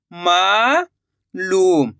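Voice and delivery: "मालूम" very slow, long rising मा, pause, falling लूम; drawn-out vowel. Shown as a demonstration. Teacher completing the word for learners, "मालूम" drawn out in two parts. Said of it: "उच्चारण खींच कर आ रहा है".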